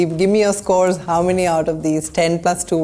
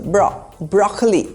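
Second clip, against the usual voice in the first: BRO first, then BROCK-uh-lee for broccoli; stress on first syllable. Shown as a demonstration. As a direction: hit the start of broccoli. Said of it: "emphasis is on the first syllable".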